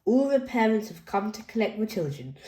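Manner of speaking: ordinary delivery, nothing marked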